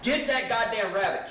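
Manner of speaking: retold impatient shout; exasperated order. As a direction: order a friend about urgently.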